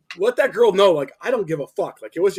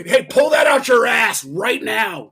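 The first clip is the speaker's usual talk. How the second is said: loud, slow, higher mock yell; demanding drunk; 5 semitones higher, 4 dB louder, slower than usual. Drunk customer demanding the hardest song from musicians, pushy yell. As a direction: imitate a pushy drunk hollering a request.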